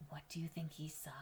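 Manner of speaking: put-on whispered question, drawing the child in; suspense whisper. Reading to a child, whispering a suspenseful question. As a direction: hush it like a secret around the corner.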